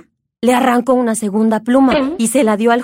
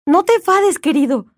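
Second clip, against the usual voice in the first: timid; 6 semitones higher than usual. A narrator's reading. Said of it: "dijo tímidamente la esposa".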